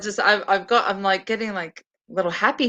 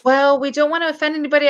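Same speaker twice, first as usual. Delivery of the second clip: higher-pitched mock teacher voice; gently fretting teacher impression; worried teacher; 6 semitones higher, faster than usual. Acting teacher cautioning the acting group, mildly worried. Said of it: "the teacher usually is like".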